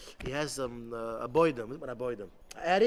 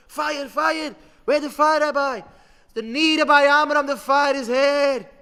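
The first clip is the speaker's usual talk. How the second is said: loud, high, urgent exchange; alarmed shouting; 8 semitones higher, 13 dB louder than usual. Retold or imitated. Yeshiva boys and rabbi shouting over where the fire is. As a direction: shout across a panicking crowd.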